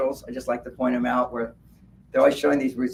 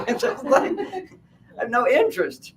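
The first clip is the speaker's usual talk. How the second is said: laughing; 9 semitones higher than usual. Real, not acted. Laughing protest.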